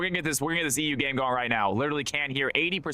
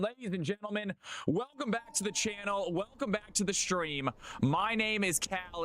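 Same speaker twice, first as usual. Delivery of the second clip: hype announcer; 7 semitones higher than usual. Acted. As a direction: greet the crowd like a ring announcer. Streamer's hype intro again.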